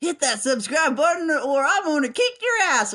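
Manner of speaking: lightly voiced old prospector; a hint of a cackling toothless ranter. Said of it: "that just sounded like an old prospector".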